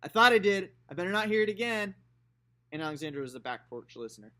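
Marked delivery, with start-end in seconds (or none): 0.0-2.0 put-on high, loud scolding old lady; scolding grandma. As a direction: call out a sharp warning from the porch. Grandma's final warning.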